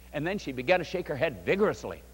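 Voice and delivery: ordinary delivery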